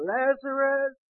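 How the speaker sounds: high, drawn-out calling; acted yell. Preacher plays Jesus calling the dead man, acted yell. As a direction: call out to someone inside a tomb. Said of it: "And he yelled".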